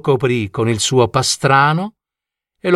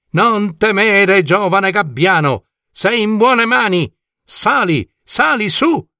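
higher-pitched, rousing storybook coachman; encouraging dragon coachman; 6 semitones higher than usual. Acted. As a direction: urge the frightened youngster aboard. Coachman urges the frightened gull aboard. (cocchiere fantastico che incoraggia, tono acuto)

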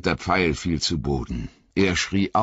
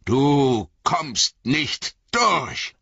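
higher, very slow steady defiance; Gandalf standing firm; 7 semitones higher, much slower than usual. Acted. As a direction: plant your feet and deny it passage. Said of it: "sagte er".

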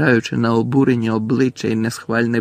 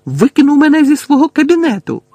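high, fast, loud yell; outraged high-pitched shout; 9 dB louder, faster than usual. A narrator's reading. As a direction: scream it out in a rush. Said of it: "зарепетував Гаррі".

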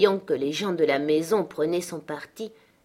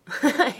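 rire gai; 7 semitones higher than usual. Acted. Catherine rit en la voyant.

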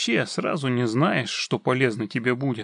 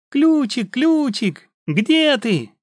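high squeaky clownish sing-song; squeaky little voice; 11 semitones higher, 7 dB louder, much slower than usual. Acted. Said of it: "Выводил при этом ещё писклявым голоском".

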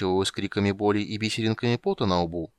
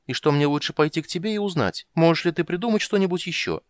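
higher, louder, chattering kender; кендер Тас, взволнованный рассказчик; 7 semitones higher, 4 dB louder than usual. Acted. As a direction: tell it eagerly in a lifted, lively voice. (взволнованный болтливый кендер)

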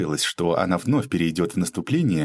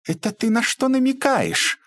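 very high indignant challenge; fly up in pitch, offended; flaring up. A narrator's reading. Lady challenging a bitter insinuation, flaring up shrilly. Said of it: "взвилась дама".

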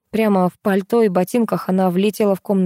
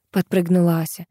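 ordinary delivery, nothing marked

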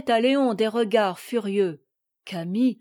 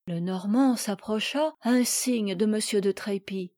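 ordinary delivery, nothing marked

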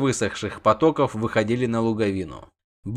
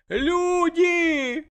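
high breathless cry, drawn out; high drawn-out alarm cry; panicked call. A narrator's reading. Shoemaker runs in shouting.

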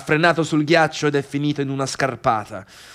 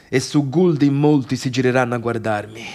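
ordinary talk

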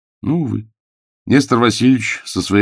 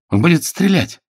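frightened; 10 semitones higher, 3 dB louder than usual. Acted. Frightened assistant warns.

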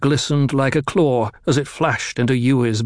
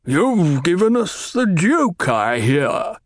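Baron voice; 4 semitones higher, slower than usual. A narrator's reading. Baron speaks.

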